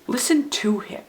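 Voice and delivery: "to" lifted out of the line; stress on TO. Shown as a demonstration. Teacher recapping the three readings for children, stress on "to". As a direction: punch the middle word "to". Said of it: "when you stress a different word".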